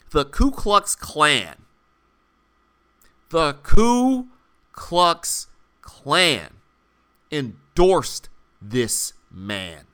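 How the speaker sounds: Ku Klux Klan spat out slowly, each word hit hard; slow emphatic outrage. Real, not acted. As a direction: drag out Ku Klux Klan with disgust. Emphatic outrage, slowed and drawn out.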